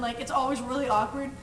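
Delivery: ordinary delivery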